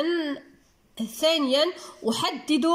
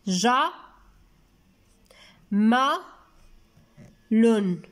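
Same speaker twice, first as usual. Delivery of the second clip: ج-، م-، لن as isolated beats, long pauses between; split into syllables; 3 semitones lower, much slower than usual. Shown as a demonstration. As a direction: dictate ج-، م-، لن one syllable at a time. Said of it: "باش هكذا يزيد يوضح لنا".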